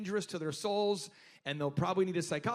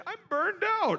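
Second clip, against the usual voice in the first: mock whiny complaint, pitched much higher; mock whiner; 10 semitones higher than usual. Mocking people who complain of burnout, high whine. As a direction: whine it like a weakling. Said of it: "I never go home and say".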